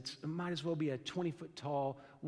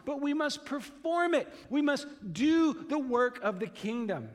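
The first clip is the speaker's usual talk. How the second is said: preaching at full force; 5 semitones higher than usual. Acted. Pastor urging the congregation to live God's truth, in a fervent preaching voice. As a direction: preach it with rising urgency. Pastor presses the lawyer's exam.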